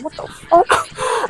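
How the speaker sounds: high, slow, drawn-out what the fuck; hammed-up shocked reaction at the party; stunned disbelief. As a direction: stretch it out in pure disbelief. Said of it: "I'm sitting there like".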